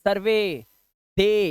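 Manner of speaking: सर्वे and दे with long "ay" endings. Shown as a demonstration. Teacher showing how to pronounce सर्वे, दे, long ay. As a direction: draw out the ay in सर्वे and दे. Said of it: "इस तरह से आप इनको उच्चारित करेंगे".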